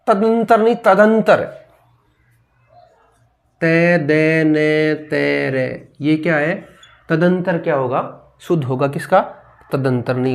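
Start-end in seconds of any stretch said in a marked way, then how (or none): 3.6-5.9 very slow letter by letter; spelling out. Shown as a demonstration. Teacher spells the word for students, very slowly. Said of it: "spelling out letters".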